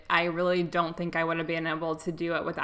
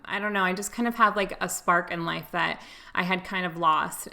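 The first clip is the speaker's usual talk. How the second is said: usual speaking voice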